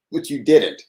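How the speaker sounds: ordinary delivery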